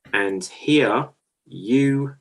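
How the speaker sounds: slow, stretched game-show cue; slow dramatic announcer cue; drawn-out host cue. Acted. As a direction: drag it out to build suspense. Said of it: "host cue".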